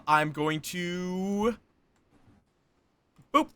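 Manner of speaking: ordinary talk, nothing marked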